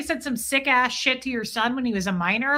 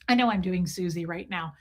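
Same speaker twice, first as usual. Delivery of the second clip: normal talking voice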